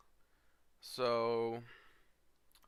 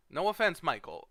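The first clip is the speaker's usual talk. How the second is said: loud, high exasperated jab; 6 semitones higher, 6 dB louder than usual. Real, not acted. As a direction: throw it out, fed up.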